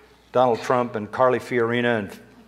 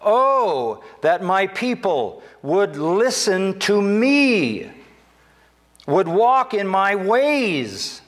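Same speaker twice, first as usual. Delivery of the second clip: higher, very slow, longing pulpit cry; slow, higher lament as God; lamenting preaching; 8 semitones higher, 3 dB louder, much slower than usual. Acted. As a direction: ache over the people who won't listen. Quoting God's lament.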